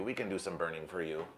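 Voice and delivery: softly repeated offer; hushed repeat. Shown as a demonstration. Repeats line two ways.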